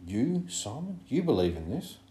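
high, incredulous questioning; amazed. A narrator's reading. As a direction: ask in sheer disbelief, pitch leaping up. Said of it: "stood up in amazement".

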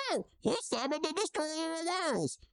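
put-on high, posh old gent; Pops, high and cheerful. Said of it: "it says".

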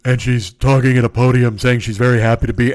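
put-on deep podium voice; mock her affected deep voice, projecting firmly; fake-deep speech from a podium; Elizabeth Holmes. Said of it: "she had this deep voice".

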